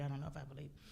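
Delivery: low, soft, trailing off; quiet, deflated admission; doubtful murmur. Retold or imitated. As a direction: let the doubt sink away.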